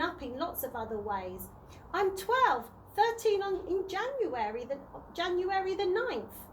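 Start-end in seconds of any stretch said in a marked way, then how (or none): 1.9-6.4 put-on raised, eager girl's voice insisting on her age; proudly insisting she's nearly grown. A narrator's reading. Chloe to the tramp, insisting on her age, eager girl voice.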